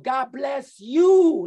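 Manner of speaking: loud, slow and high. Retold or imitated. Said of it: "like, so loud".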